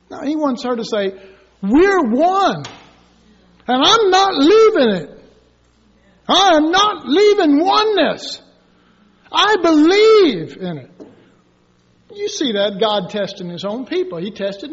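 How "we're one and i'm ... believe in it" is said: high, louder, resolute vow; wife standing firm for their marriage, loud and sure; firm declaration. Acted. Wife's firm answer the Lord wants.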